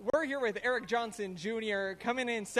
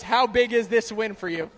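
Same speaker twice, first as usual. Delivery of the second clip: ordinary delivery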